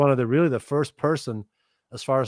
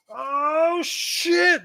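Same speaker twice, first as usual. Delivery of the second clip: much higher, excited yelp; mock hyped homie cheering; hyped exclamation. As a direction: hype up like a crowd that's impressed. Crips hyped by bass line.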